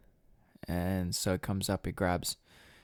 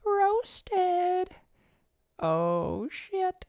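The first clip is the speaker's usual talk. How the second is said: very high, drawn-out falsetto; playful high-pitched taunt; mock crowd jeer; much slower than usual. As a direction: squeal it like a heckling onlooker. Mock crowd reaction to the putdown.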